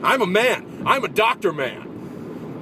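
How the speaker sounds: high, hammed-up protest, last word drawn out; mock-angry outburst. Actor protesting to a heckler that he's a doctor, mock-angry outburst. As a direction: overblown indignant cry. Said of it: "It made me angry".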